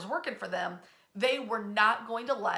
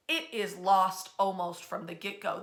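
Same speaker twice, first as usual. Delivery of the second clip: ordinary talk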